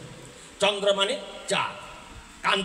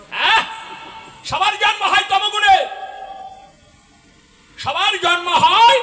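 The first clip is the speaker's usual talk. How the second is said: preaching shout; 11 semitones higher, 7 dB louder than usual. Acted. Preacher hammers home his point.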